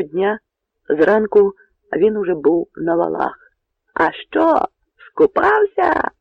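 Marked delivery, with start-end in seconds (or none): 3.9-6.1 very high, loud mocking call; shouted taunt. A narrator's reading. Poles call to Bohun on the ramparts, mocking shout. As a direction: tease him at the top of your pitch. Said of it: "кричали поляки".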